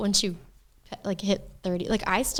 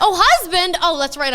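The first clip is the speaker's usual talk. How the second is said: high, loud startled laugh-cry at the word husband; oh husband, yelped loud and high; surprised; 9 semitones higher, 10 dB louder than usual. Real, not acted. Reacting to husband search result.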